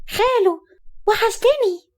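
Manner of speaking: put-on very high-pitched little boy calling out; child's shout. A narrator's reading. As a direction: cry out like an excited small child. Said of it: "وهو بيصرخ".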